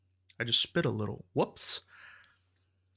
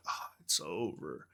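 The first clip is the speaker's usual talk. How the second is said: low, soft, slow letdown; dejected sigh; 8 semitones lower, 10 dB quieter than usual. Retold or imitated. Fan watching the band leave, dejected. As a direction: sigh it out as reality sinks in. Night ends, acted dejection.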